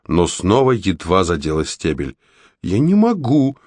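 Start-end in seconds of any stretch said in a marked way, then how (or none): 2.6-3.6 high-pitched, understated whimper; a touch of tearful helplessness; sobbing protest. A narrator's reading. Red gives up on chopping the stalk, lightly sobbing. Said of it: "всхлипнула она".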